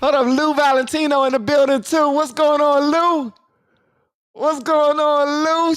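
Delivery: spotting a fan in chat, thrilled; excited greeting. Real, not acted. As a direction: hail a fan with delight. Excited shout-out to viewer.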